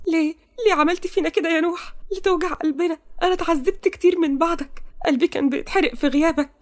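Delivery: high-pitched, hurt pleading; tearful reproach. Acted. Heroine reproaches her returned beloved, tearfully. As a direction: reproach him in a high, acted crying voice. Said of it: "وهي بتعيط".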